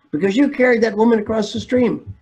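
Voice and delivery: high, exasperated accusation; angry monk. Retold or imitated. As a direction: throw the blame like an outraged monk. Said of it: "The angry monk".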